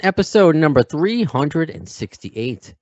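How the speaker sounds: loud, upbeat announcer read; podcast host intro. Acted. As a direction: announce the show with energy.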